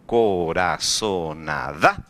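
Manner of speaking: coraçonada drawn out slowly, ending in a questioning rise on DA; stress on the last syllable. Shown as a demonstration. Teacher testing students on where coraçonada is stressed, stressing the final syllable. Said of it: "quando eu falo a palavra coraçonada, eu falo".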